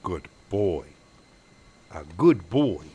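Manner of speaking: tender pet talk for the camera; dog ASMR. Real, not acted. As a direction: coax the dog gently, half whispering. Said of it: "the Henry A S M R".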